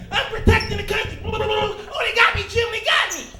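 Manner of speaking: high, loud hammed-up battle cries; mock war hero. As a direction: play a would-be hero going down dramatically.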